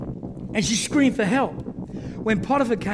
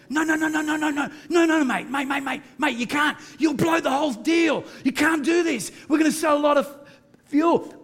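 frantic shouted pleading; 10 semitones higher than usual. Retold or imitated. The salesman shouting at David not to walk out, frantic. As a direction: shout at him not to leave. Salesman begging him to stay.

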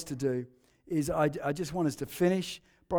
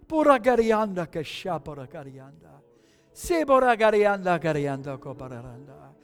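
speaking in tongues; 2 semitones lower than usual. Real, not acted. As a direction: pray in tongues, rhythmic syllables. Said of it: "why don't we speak in tongues".

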